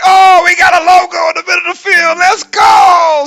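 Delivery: high, loud excited shout; acted roar of excitement; hyped crowd. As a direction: yell like a geeked-up team at a pep rally. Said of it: "And everybody were like".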